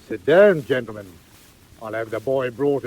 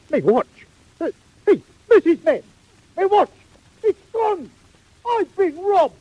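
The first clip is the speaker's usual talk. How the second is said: rising panicked cries, much higher; alarmed outcry; 6 dB louder than usual. Acted. Beadle discovers his watch is stolen, high alarmed cries. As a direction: shout for Missus Mann in alarm.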